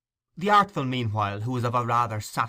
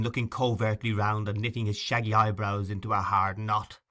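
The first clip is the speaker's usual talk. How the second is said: usual speaking voice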